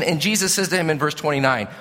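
usual speaking voice

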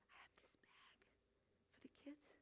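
barely audible, sheepish whisper; embarrassed whisper. Retold or imitated. Pastor admits the bag to woman in charge, whispered. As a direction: confess it under your breath.

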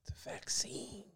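slow, secretive whisper. Pretend. Ominous mention of vaccine.

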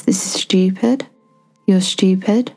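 usual speaking voice

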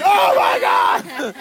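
high, drawn-out shocked cry; shocked shout. Retold or imitated. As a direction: kids gasping in disbelief. Students shocked in class story.

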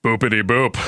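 low, gruff macho voice; manly voice. Pretend. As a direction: puff up like a tough guy. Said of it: "in a really manly voice".

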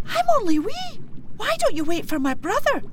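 very high, squeaky plea; pleading little cow. Acted. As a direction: beg like a tiny calf. Tiny calf pleads with kelpie.

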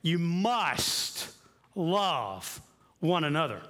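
a hint of a solemn Jesus, slowed; lightly voiced Jesus giving a command; voicing Jesus. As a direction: quote the command gravely and slow. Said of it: "He said".

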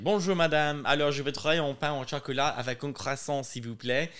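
proper French order, lightly put on; careful French accent. Ordering a croissant from the woman, careful French accent. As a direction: order politely in his best French. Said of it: "I do speak French, actually, pretty well".

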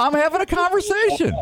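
high-pitched self-justifying outburst; mock indignant protest. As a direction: defend yourself in a squeaked-up, hammed-up protest. Retold protest to wife's scolding.

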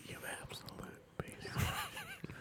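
slow, barely audible whisper; mock whisper. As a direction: whisper the insult slowly and very softly. Mock ASMR whispering into microphone.

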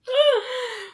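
sharp high gasp; quick audible intake of breath. Real, not acted. Host reacts to cohost's joke, sharp gasp.

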